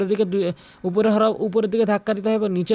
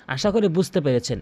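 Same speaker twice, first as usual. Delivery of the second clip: ordinary talk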